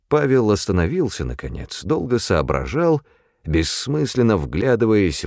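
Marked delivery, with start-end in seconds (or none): none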